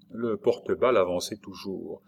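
ordinary delivery

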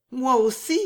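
eager lifted reply; Riquette. Acted.

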